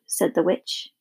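ordinary delivery, nothing marked

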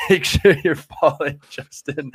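joking, laughing. Real, not acted. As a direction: laugh through the words. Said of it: "I'm being sarcastic".

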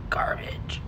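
whispered aside. Real, not acted. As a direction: drop to a whisper on it. Aside about the ratings.

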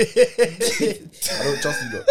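high squealing laugh through the words. Real, not acted. Teasing his friend while cracking up laughing.

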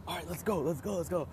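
high, eager rush to go; excited urging. Retold or imitated. Hunter urging his friend to track the shot deer, excited. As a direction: hurry your buddy after the deer, keyed up. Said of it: "I'm, like, freaking out".

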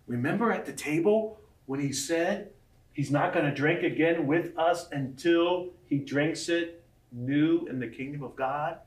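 normal talking voice

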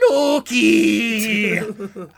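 hammed-up long cry of a brother's name; Miko. Miko answers his brother psychically, mock wailing call. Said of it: "And Miko goes".

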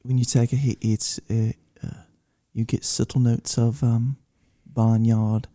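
measured, halting mock British accent. Cigar reviewer describing subtle notes, calm British accent. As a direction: pause over each note like a British connoisseur. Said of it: "you sounded just like [name]".

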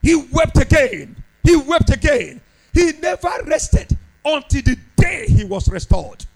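high, loud, pounding sermon cry; high impassioned preacher's shout; full-force preaching. Acted. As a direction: preach it out with all your lungs.

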